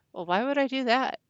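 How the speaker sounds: incredulous question. Retold or imitated. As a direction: throw the question back, plainly baffled. Retold puzzled reply to accuser.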